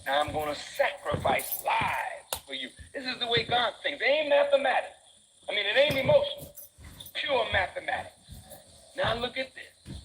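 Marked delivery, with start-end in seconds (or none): 2.9-10.0 fiery preaching. Acted. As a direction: preach it to the back row. Preaching at full force.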